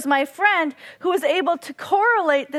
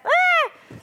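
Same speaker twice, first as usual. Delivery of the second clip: high imitated loon wail; loon cry; 13 semitones higher than usual. Telling friends about the lake, imitating the loon's cry. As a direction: mimic the loon's alarm call. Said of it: "started making that, ... sound".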